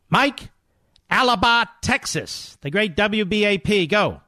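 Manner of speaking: high, bright announcer call-out of the caller's name and town; radio host announcing a caller; caller intro. Acted. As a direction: bring the next caller on air with a lift.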